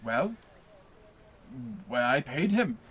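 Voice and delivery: ordinary delivery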